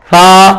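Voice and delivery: "سا" as thaa, tongue tip out; touch tongue to upper teeth for a lisped "سا"; "سا" with no whistle in the s; soft th. Shown as a demonstration. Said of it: "زبان تھوڑی سی باہر نکالیں گے".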